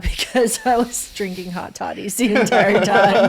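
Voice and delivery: real laughter breaking into the story; laughing. Real, not acted. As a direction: crack up while telling it. Cracking up at own drinking story.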